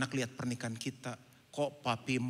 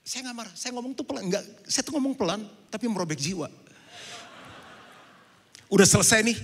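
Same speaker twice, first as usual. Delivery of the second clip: ordinary delivery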